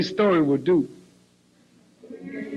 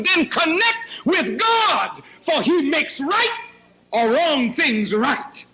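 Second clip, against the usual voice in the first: rising preaching; 6 semitones higher than usual. Acted. Preacher exhorts young people to turn to God, rising fervor. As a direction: lift them to their feet.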